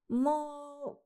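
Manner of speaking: put-on high child struggling with name; with effort. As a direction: push out the name. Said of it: "с усилием проговорила девочка".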